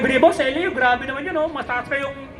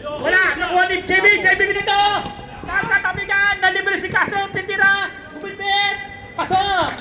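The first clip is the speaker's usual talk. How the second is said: raised, excited basketball call; live play-by-play; 5 semitones higher than usual. Real, not acted. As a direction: follow the ball in short urgent bursts.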